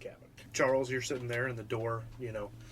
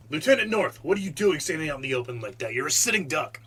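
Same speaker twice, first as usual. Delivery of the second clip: loud, fast military scolding; urgent battlefield shout; 3 semitones higher, 10 dB louder than usual. Acted. Soldier warns his lieutenant under fire, urgent shout. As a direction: yell at an officer over gunfire.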